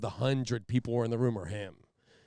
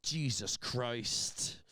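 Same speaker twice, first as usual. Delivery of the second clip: disgusted mutter; 4 dB quieter, much slower than usual. Retold or imitated. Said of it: "he went".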